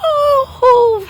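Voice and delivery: drawn-out, high wail; mock wailing. Mother frightened by a stranger at home, mock wailing. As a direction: wail "ho, ho" in hammed-up distress.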